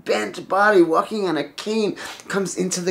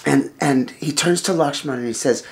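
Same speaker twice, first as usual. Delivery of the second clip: plain reading voice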